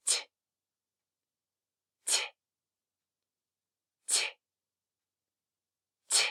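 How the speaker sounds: "t" as a voiceless tap of the tongue; only the consonant "t", no vowel; letter sound of T. Shown as a demonstration. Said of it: "this T makes the T sound".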